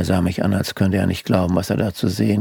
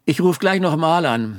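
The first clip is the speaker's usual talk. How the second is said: higher-pitched, hurried brush-off; curt; 8 semitones higher than usual. A narrator's reading. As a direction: cut Mother off like someone caught out. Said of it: "sagte ich kurz angebunden". (kurz angebunden, mit erhöhter Stimme)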